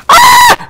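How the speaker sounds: very high, loud shriek; piercing scream on getting killed; sudden high yelp; startled scream. Real, not acted. Killed again in game.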